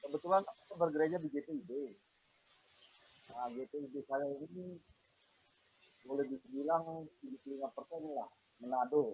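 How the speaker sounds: faint, far from the mic; barely audible. Real, not acted. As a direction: voice fading into the distance. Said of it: "Suaranya terlalu kecil". (suara sangat pelan, hampir tak terdengar)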